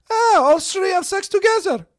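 put-on high, loud shout in a Russian accent; a high mock Russian shout, shocked. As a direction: shout it at them in disbelief. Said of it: "Like, shouting at them".

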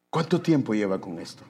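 ordinary talk, nothing marked

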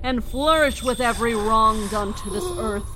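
zealous cult leader declaring victory; fervent sermon. Acted. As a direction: preach it to the crowd at full force. Leader preaching over the carnage.

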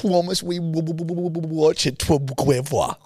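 stammering whine impression. Teasing Louise by imitating her stammered complaint. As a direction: stutter through promise like she's upset. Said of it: "that's exactly how you'd say it".

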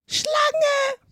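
very high, loud bird shriek; screeching. A narrator's reading. Pigeon attacking Alice as a serpent, screeching. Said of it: "kreischte die Taube".

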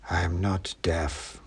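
slow, soft, purring menace; a big cat murmuring from the dark; throbbing cat voice. A narrator's reading. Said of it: "said a throbbing voice from the shadows".

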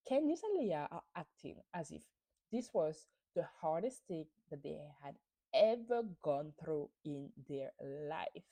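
ordinary talk

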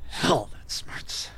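slow hissed whisper, stinging; pained whisper. Acted. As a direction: wince it out under breath. Stinging wounds.

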